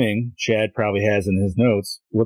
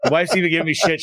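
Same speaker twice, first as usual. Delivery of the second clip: ordinary delivery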